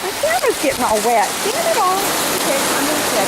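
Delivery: flustered. Real, not acted. Rushing from rain, camera wet.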